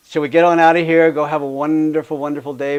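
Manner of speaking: loud, bright send-off to the class; rallying the class to go; cheery call-out. Real, not acted. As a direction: call them out the door. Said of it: "cheery send-off call".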